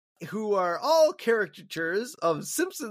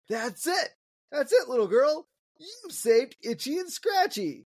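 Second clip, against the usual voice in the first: higher, thrilled and gushing; excited; 8 semitones higher than usual. Retold or imitated. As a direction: burst out delighted, drawing it out. Roger Myers thrilled at little girl.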